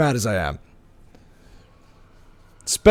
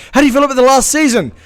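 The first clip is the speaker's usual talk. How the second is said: higher and louder, fast, laughing through it; mock interviewer; 10 semitones higher, 8 dB louder, faster than usual. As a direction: ham up a chirpy interviewer with a laugh. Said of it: "interviews where".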